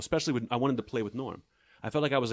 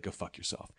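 deadpan mock insult; 3 semitones lower, 10 dB quieter than usual. Mock insult to nervous fan.

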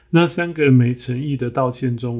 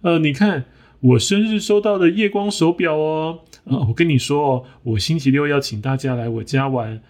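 higher, chirpy show-off chatter; boastful hen; 4 semitones higher than usual. Acted. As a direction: brag eagerly like a vain hen. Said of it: "他说".

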